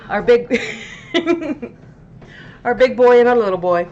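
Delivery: high, fast, laughing through words; laughing pet talk. Real, not acted. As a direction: giggle while praising the pets. Laughing, cooing over pets.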